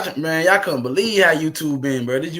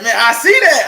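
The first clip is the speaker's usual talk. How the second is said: loud, high, laughing through it; laughing reply; 8 semitones higher, 8 dB louder than usual. Retold or imitated. Streamer answering his friend's shout-out, laughing loudly.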